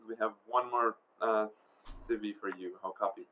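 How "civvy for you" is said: usual speaking voice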